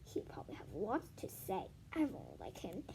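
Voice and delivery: soft, whispered wishing; hushed inner thought. A narrator's reading. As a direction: whisper it like a secret hope.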